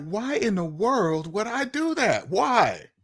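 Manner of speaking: slow, incredulous foreigner voice; perplexed foreigner. Retold or imitated. Foreigner hearing about mortgages, slow perplexed questioning. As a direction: drag out the disbelief. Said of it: "they'd be like".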